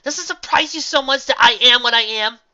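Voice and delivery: high, loud angry voice; angry shout. A narrator's reading. Astor and Valiant argue angrily, loud. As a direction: shout back at him. Heated argument.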